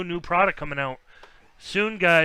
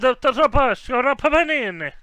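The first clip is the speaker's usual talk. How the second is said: put-on high loud hammy Italian accent; 9 semitones higher, 8 dB louder than usual. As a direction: say it like a proud Italian chef, Italian accent. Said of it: "that's my Italian accent".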